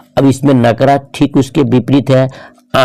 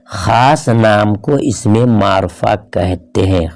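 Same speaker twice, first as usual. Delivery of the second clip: slow, spaced-out words; slow, measured definition; 2 semitones lower, slower than usual. Shown as a demonstration. Teacher defining proper nouns to students, slow and deliberate. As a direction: dictate it slowly, word by word.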